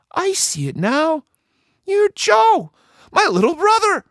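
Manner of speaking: very high, surprised voice; dawning recognition. A narrator's reading. Stinky Annie recognizing her little brother, surprised. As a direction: realize it's your brother.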